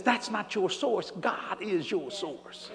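slow hushed truth. Real, not acted. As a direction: lean in and say it softly. Preacher drops to hushed aside.

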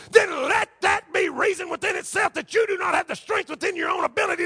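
high, driving pulpit voice; impassioned sermon, hammering the rhetorical questions; full-force preaching. Acted.

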